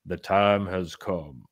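slow, portentous quote; lightly dramatized solemn reading; mock-solemn letter reading. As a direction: read it like a grave documentary narrator, slowly. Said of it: "I can't do Vin Diesel justice".

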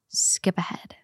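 soft whispered aside. Real, not acted. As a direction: drop to a whisper. Trigger warning aside.

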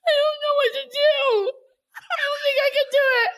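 high, teary wail; crying. Real, not acted. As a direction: whimper it out close to tears. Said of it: "I'm crying".

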